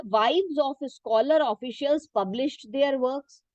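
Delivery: reading a textbook sentence very slowly for students to follow; teacher reading the book aloud, slow and measured. Shown as a demonstration. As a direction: dictate it slowly, word by word.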